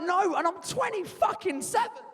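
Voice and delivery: high, indignant outburst; indignant refusal. Acted. Grown son refusing his mum at twenty-seven, indignant. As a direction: dig in, voice pitched right up. Grown son's argument retold.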